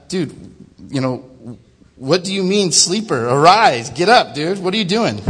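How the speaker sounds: hammed-up angry captain shouting down the hold. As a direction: bellow him awake, half playful. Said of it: "No, okay, sorry".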